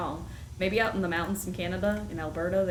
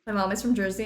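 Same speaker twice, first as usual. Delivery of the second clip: usual speaking voice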